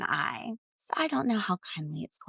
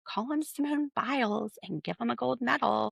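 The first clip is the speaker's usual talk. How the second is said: normal talking voice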